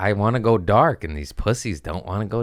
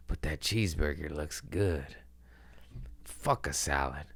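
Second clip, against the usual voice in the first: quiet temptation; 3 semitones lower, 10 dB quieter than usual. Retold or imitated. Tempted at lunch by cheeseburger.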